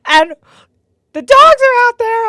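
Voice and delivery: high, loud hysterical rant; retold tearful yelling. Crying daughter to mom about dead groundhog, yelling. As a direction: yell it frantically, worked up and mad. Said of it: "I'm crying and I'm mad, like, yelling at her".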